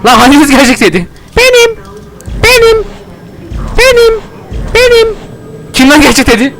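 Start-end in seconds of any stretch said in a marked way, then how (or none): 1.4-6.5 high cartoon voice repeating it's me; a row of cheeky little impostors; squeaky impostor chorus. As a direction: chirp it again and again up high. Many Teddies answer in high voice.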